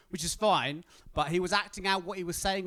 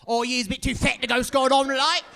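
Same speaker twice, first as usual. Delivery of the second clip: high, much louder blunt announcement; mock Aussie staff guy; 8 semitones higher, 9 dB louder than usual. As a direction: announce it to everyone cheerfully. Guy explains to girl loudly.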